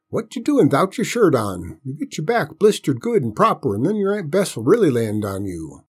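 grandpa's singsong scolding; folksy old grandpa, chiding. Acted. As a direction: warn the boy like a country granddad. Voices old grandpa scolding boy.

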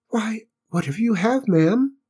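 meek, squeaky reply, pitched high; timid little Cinders. A narrator's reading. Cinders answers the barking cook, small timid voice. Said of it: "he managed to bow and whisper".

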